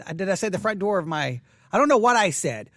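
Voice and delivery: ordinary talk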